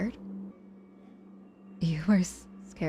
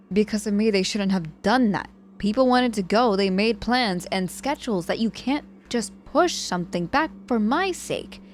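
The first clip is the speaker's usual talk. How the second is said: louder, higher, insistent complaint; frustrated protest; 3 semitones higher, 5 dB louder than usual. Acted. Patient scolding that plans changed for her sake, loud frustration. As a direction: push back hard, exasperated. Frustrated guilt over party.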